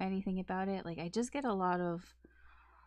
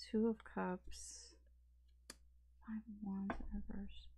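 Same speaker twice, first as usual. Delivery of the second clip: whispered; 9 dB quieter than usual. Real, not acted. Reading tarot cards to herself.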